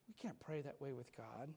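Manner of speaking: ordinary talk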